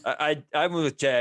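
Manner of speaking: ordinary talk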